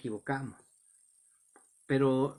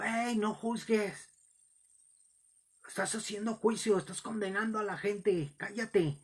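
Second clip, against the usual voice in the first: retold shout; 7 semitones higher than usual. Young man heckles the bus preacher, imitated high-pitched shout. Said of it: "ese muchacho me gritó".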